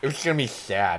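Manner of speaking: "sad" said as "shad"; put-on slushy S on "sad"; playful lisp turning "sad" into "shad"; slushy speech. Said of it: "when we do that".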